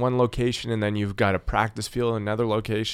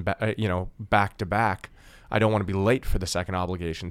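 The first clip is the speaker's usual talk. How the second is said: ordinary talk, nothing marked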